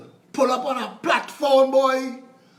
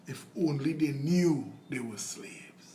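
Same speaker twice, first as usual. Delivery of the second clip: softer, worn-out whisper; exhausted whisper; 6 semitones lower, 7 dB quieter than usual. Retold or imitated. Harriet, exhausted, confides in a whisper. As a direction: let it fade to a tired whisper. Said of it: "in her exhaustion, one day said".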